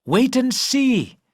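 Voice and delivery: slow, high, playful call back. Acted. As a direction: sing it out teasingly from a distance. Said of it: "Christine called back".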